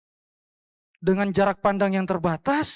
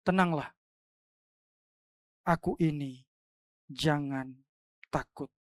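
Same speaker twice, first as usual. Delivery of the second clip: gentle reassurance; 4 semitones lower, 5 dB quieter than usual. Acted. Jesus quoted calming disciples.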